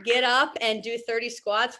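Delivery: ordinary talk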